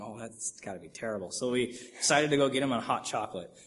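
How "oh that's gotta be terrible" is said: soft, slightly lowered inner thought; quiet sympathy. Retold or imitated. Watching the freezing security guard, quietly sympathetic. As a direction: murmur it softly, as if to yourself.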